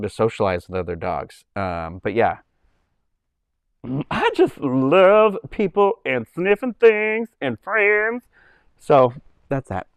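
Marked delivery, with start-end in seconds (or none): 4.1-8.2 high, slow sweet puppet voice; very high slow doggy puppet voice. Shown as a demonstration. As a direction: gush like a happy little dog. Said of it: "voicing a puppet dog".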